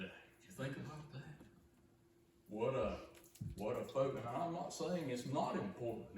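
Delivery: ordinary delivery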